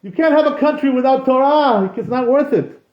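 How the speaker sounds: hammed-up tearful outcry; the weeping rabbi. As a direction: play the sage crying out in grief. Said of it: "Ah, he says".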